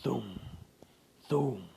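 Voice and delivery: ordinary delivery, nothing marked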